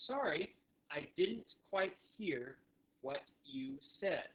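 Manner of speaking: slow, separated words, falling at the end; deliberate teaching delivery; slow phrase model. Shown as a demonstration. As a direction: model it word by word. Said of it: "All right, now all together".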